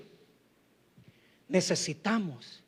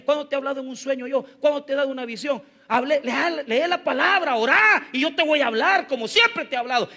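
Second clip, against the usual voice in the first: fast, rising rebuke, louder toward the end; God's scolding; 6 semitones higher, 4 dB louder than usual. Retold or imitated. God rebuking the preacher for asking for dreams, fast and heated. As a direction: voice God chiding a lazy servant.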